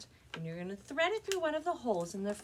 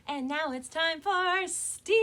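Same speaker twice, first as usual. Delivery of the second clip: louder, higher and slow, like kicking off a show; big announcement; 8 semitones higher, 8 dB louder, slower than usual. Acted. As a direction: announce the segment with a flourish. Announcing the STEAM segment.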